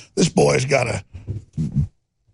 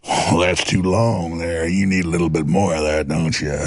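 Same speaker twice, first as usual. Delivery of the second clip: folksy pitchman; 10 semitones lower than usual. Retold or imitated. Same mock pitchman continues.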